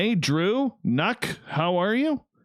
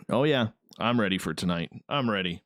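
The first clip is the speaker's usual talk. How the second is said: usual speaking voice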